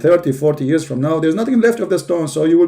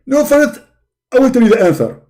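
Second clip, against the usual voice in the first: high, loud, indignant preacher voice; Zakir Naik impression; 9 semitones higher, 5 dB louder than usual. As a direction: bark it like a self-righteous debater. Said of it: "My name is Zakir Naik".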